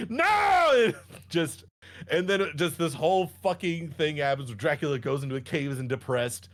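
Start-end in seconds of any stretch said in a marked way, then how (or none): 0.1-0.9 high, loud mock scream; hammed-up cry. Cultist crying out as Dracula kills him, mock scream.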